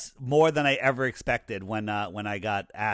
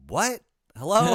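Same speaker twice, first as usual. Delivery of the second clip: higher, louder startled "What? Hello?"; stunned disbelief; 7 semitones higher, 5 dB louder than usual. Retold or imitated. Caller hears he's wanted on InfoWars, shocked and incredulous. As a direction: blurt it out like you can't believe the call.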